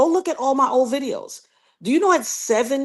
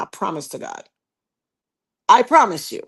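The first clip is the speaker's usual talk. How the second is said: ordinary talk, nothing marked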